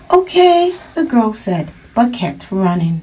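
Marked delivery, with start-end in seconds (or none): 0.1-0.8 put-on high child voice; little girl's reply. Girl answering her mother while running, high child voice.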